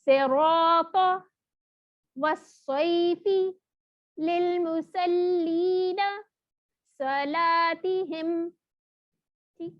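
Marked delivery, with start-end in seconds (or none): 0.0-8.5 each word drawn out very slowly; slow tajweed practice. Shown as a demonstration. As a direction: recite the examples slowly for practice. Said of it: "ہم اس کی تھوڑی سی practice کر لیتے ہیں".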